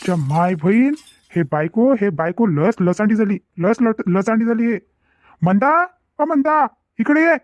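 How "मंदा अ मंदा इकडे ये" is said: calling out. Acted. Husband calls wife Manda over, drawn-out high call. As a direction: stretch her name out to reach the next room.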